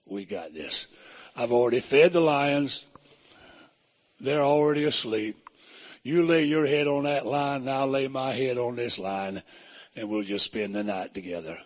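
a deep, easy reassurance from beside them; the Lord's voice. Retold or imitated. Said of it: "the Lord said".

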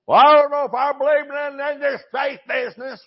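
high, loud mocking skeptic voice; preacher mocking a doubter's whining objection.

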